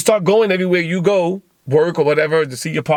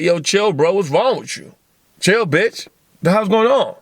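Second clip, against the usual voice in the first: mild, higher-pitched exasperated challenge; mock confrontation; 5 semitones higher than usual. Podcaster acts out confronting an angry roommate, mildly exasperated.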